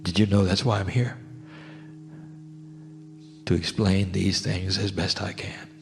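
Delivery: ordinary delivery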